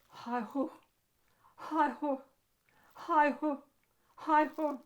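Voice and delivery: mock panting. Herbert demonstrating his scary mouth breathing, panting.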